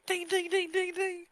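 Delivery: high, loud, ringing dings; be a bell, rapid high-pitched strikes; imitated bell dinging. Player shows he was a bell, loud high dinging. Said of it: "you were a bell".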